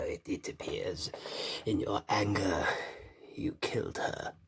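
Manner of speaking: slow, soft, menacing whisper; sinister Emperor whisper. Retold or imitated. Collector quotes the Emperor to Vader, sinister whisper. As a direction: hiss it slowly like the Emperor.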